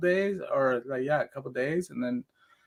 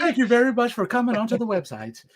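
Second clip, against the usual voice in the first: customer service voice; 8 semitones higher than usual. Pretend. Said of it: "My customer service voice".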